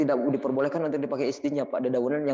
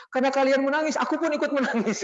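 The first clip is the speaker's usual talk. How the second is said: high-pitched imitation of crying; retold weeping, voice pitched way up; mock tearful voice; 13 semitones higher than usual. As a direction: wail it like a hammed-up weeper. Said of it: "Nangis Umar tuh terseguk-seguk".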